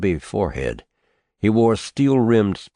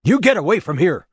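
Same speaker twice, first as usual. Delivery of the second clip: sharp shout, much higher and louder; 12 semitones higher, 5 dB louder, faster than usual. Acted. Nephew shouting the old man away from his door, sharp shout. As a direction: drive him off with your voice. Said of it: "the nephew shouted".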